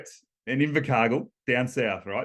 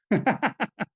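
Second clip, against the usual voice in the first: laugh; 9 semitones higher than usual. Real, not acted. Laughing at his own BMX story.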